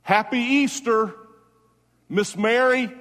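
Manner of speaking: called out high and loud, slowly; calling out to Mary. Real, not acted. Preacher calls out Easter greetings to the late Miss Mary, loud and high. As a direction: lift it up like a greeting across to heaven.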